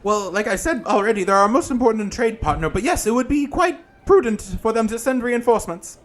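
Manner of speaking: campy drag queen. Acted. GM voices Granny Who.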